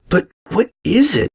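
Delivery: a small pony pressing for the truth; frightened filly demands to know what it is; anxious filly. Acted. Filly pleads to understand.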